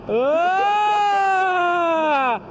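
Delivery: a huge fiery dragon roar, shouted high. Shown as a demonstration. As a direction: let out a big dragon roar. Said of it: "could you do it for us?".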